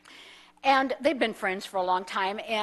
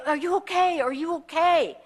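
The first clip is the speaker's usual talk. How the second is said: a higher, urgent "Are you okay?" from a helper with little English; concerned stranger in broken English; 5 semitones higher than usual. Retold or imitated. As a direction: ask anxiously, searching for the words. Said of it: "in whatever broken English he could say".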